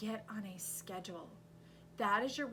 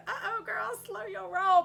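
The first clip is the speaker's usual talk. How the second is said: much higher and louder, teasing caution; sassy warning; 12 semitones higher, 13 dB louder than usual. Retold or imitated. To the fired-up viewer, a high sassy warning. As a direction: tease her to calm down, high and sassy. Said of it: "I'm thinking".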